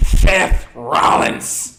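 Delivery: loud, slow, smug heel wrestler announcing himself; hammed-up villain wrestler intro; heel Seth Rollins. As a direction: boom out your own name like a cocky heel. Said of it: "That's the best impersonation".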